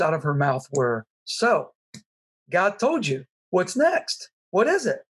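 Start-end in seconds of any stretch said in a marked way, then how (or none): 1.2-5.0 eager rising questions, pitched higher; bubbling over with excitement, smiling through it; beaming excitement. Retold or imitated. Wife beaming at husband on the mountain, excitedly asking. She was just beaming with a smile.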